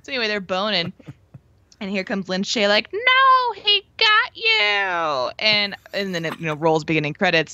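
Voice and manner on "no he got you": high, very slow wail; anguished wail. Retold or imitated. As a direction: wail in despair. Quoting Lin Shaye despairing.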